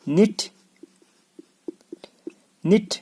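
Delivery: high-pitched निट starting on N; silent K. Shown as a demonstration. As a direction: model निट as "nit". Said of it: "के silent".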